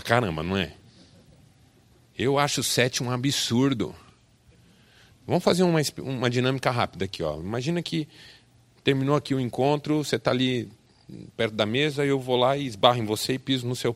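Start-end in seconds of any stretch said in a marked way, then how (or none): none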